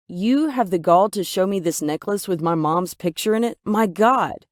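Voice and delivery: outraged. Acted. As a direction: blow up at him in disbelief. I was incensed, outraged.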